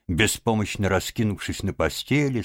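usual speaking voice

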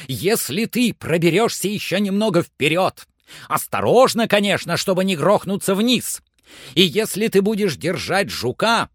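high, loud persuasive call; eager commanding Legrand. A narrator's reading. Master promises climber a silver dollar, eager loud urging.

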